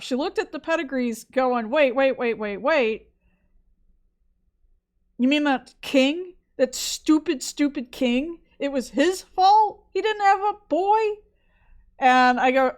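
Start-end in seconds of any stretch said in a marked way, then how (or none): 1.7-11.0 rising, indignant disbelief, climbing higher and slower; a student suddenly realizing the king is to blame; outraged realization. Retold or imitated. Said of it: "one of my students suddenly just went".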